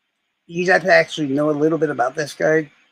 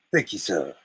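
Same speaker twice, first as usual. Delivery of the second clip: low, softer Southern gentleman; Robert E. Lee; 10 semitones lower, 5 dB quieter than usual. Retold or imitated. General parting from Lincoln, low Southern gentleman voice. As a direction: take leave quietly in a Southern accent. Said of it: "So Robert E. Lee comes in".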